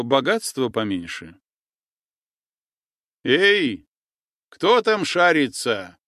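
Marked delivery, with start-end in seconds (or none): 3.2-6.0 a stern challenge called out into the dark. Acted. Sentry on the boat sternly challenging the stranger. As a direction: demand who is there, sternly. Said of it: "строго окликнули со струга".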